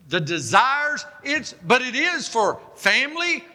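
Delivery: high, impassioned sermon delivery; full-force preaching. Real, not acted. Preacher urging congregation to fast for deliverance, impassioned.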